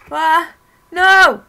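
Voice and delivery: high, very loud scream of terror; terrified scream. Acted. Grabbed by something, screaming in terror.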